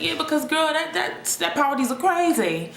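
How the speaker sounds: high, loud, sassy burst; suddenly lively and high; snapping out of it. Retold or imitated. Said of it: "I would snap out of that".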